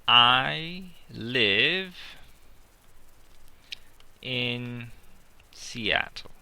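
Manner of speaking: slow, word by word with long pauses; slow dictation. Shown as a demonstration. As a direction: spell it out slowly while writing. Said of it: "I can say".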